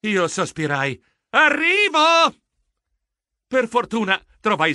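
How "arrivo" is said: high, loud, drawn-out call. Acted. Geronimo answers his waiting friend on the phone, calling out. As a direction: sing out that you're coming. Calls out after a sigh.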